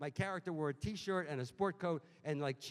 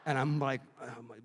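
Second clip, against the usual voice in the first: normal talking voice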